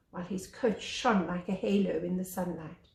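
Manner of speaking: usual speaking voice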